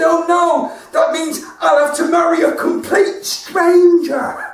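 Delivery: mock dismayed child. Granddaughter's punchline voiced.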